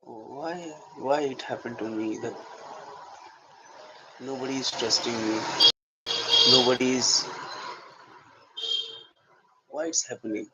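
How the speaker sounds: hushed, musing to oneself; self-talk soliloquy. Shown as a demonstration. Actor alone, asking why nobody trusts him, quiet self-talk. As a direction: murmur it privately, with long pauses. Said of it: "I was just self-talking".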